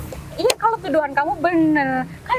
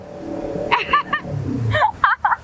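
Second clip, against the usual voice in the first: a short scornful laugh, pitched up; mocking laugh; 6 semitones higher than usual. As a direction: laugh at him to belittle what he said. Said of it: "Aku tuh pengen ketawa".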